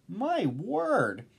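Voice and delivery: thrilled storybook crowd cry; amazed shouting. A narrator's reading. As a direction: exclaim it, building to the reveal. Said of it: "they shouted".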